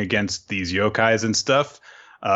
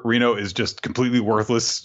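usual speaking voice

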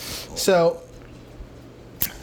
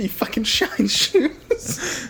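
slow, higher, laughing mock jab; mock quoting voice; 5 semitones higher, much slower than usual. Friend quoting the movie jab about shining shoes, slow and laughing. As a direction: drag it out like a jeering line.

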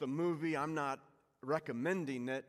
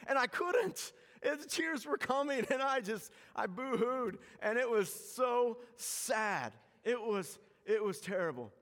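mock blubbering; 8 semitones higher than usual. Retelling crying at the movie.

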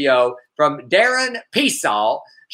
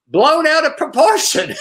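laughing; 11 semitones higher than usual. Real, not acted.

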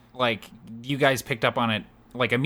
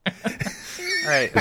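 put-on Torbjorn accent. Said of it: "do a Torbjorn accent".

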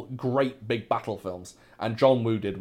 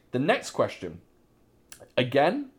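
normal talking voice

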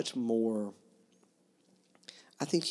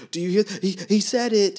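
gleeful accusation; 10 semitones higher, 10 dB louder than usual. Retold or imitated. Voicing the father accusing his son.

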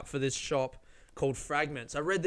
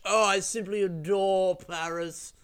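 slow, higher, hammed-up snob voice; snobby rich traveller; 4 semitones higher, slower than usual. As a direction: lay the snobbery on thick, slowly.

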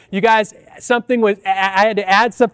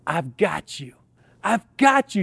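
soft, low and hushed, a mother calming her scared son; reassuring mother; 3 semitones lower, 6 dB quieter than usual. Retold or imitated. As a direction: murmur it low to comfort a frightened child. Mom reassuring on roller coaster.

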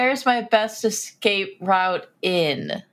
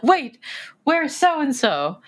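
sudden alarmed realization; 6 semitones higher than usual. Acted. Crew boarding the ship notices someone missing, sudden alarm. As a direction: stop everyone with a raised, urgent question.

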